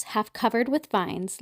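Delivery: plain reading voice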